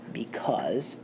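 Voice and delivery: whispered aside. Real, not acted. Trailing whisper in example.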